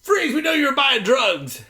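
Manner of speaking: police bursting in, hammed-up shout; mock cop shout. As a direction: bark it like a cop. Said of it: "they're just like".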